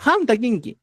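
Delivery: "ham takinki" with breathed h and closing m; southern pronunciation. Shown as a demonstration. Teacher corrects for students, "ham takinki" with breathed h. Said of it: "Esa es la pronunciación del quechua".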